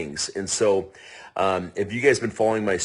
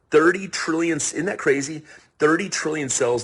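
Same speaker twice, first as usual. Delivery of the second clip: excited; 8 semitones higher than usual. Real, not acted. Marvelling at a big number.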